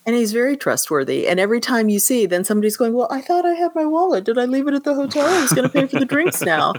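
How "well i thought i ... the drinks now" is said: high, quick puzzled voice, laughing at the end; flustered man patting his pockets; high flustered victim, faster, with a laugh; puzzled customer. Retold or imitated. Robbed customer's voiced confusion.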